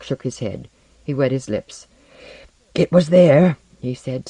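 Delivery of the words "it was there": loud, emphatic insistence; agitated character voice. A narrator's reading. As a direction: insist on it, louder and urgent. Frightened Porlock insists on his encounter.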